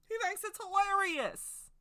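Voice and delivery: loud, slow insistent repeat; emphatic repeat. Shown as a demonstration. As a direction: say it again with big emphasis, drawn out. Repeats line, second louder.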